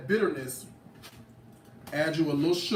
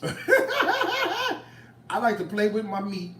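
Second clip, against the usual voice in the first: slow, high, laughing through the words; laughing, drawn out; 6 semitones higher, much slower than usual. Real, not acted. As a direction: cook repeating her joke to viewers, slow and laughing. Repeats line laughing, drawn out.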